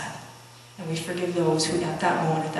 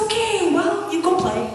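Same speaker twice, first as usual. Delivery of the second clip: cheery send-off; 11 semitones higher, 4 dB louder than usual. Retold or imitated. Mom sends the cheered son off.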